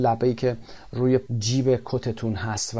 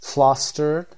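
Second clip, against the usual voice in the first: Flustered stressed on the first syllable, FLUS-tered, louder; word stress demo; 3 semitones higher, 5 dB louder than usual. Shown as a demonstration. Teacher modeling Flustered's stress for students, loud. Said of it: "استرس لبل رو هم ببینیم".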